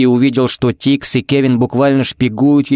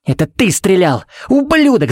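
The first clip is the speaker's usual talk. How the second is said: furious yelling; 8 semitones higher than usual. A narrator's reading. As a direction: scream the insult at the shooter. Kevin keeps yelling at shooter. (яростный крик на стрелявшего)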